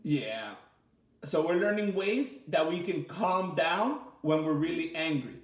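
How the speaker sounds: ordinary talk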